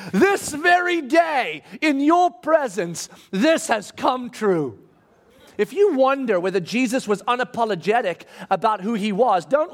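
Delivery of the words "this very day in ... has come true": high, drawn-out declaration, easing down at the end; bold proclamation. Retold or imitated. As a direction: proclaim it slowly to the whole room. Jesus proclaims to the synagogue.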